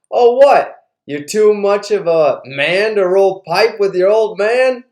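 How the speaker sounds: retold indignant challenge, pushed up high; challenging dad. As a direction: goad him like an affronted father. Dad taunts son in retold quarrel.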